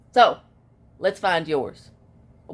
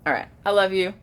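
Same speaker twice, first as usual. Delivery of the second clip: usual speaking voice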